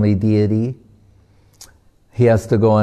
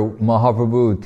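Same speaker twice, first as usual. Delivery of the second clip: ordinary delivery, nothing marked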